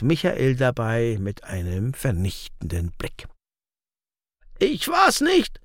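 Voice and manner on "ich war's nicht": very high, drawn-out groan of protest; groaned denial. A narrator's reading. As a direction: wail it slowly, like a man wrongly accused. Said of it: "stöhnte dieser".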